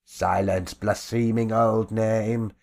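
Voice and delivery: a bully mocking his victim; sneering bluecoat leader. Bluecoat leader taunts the beaten old man, sneering loudly.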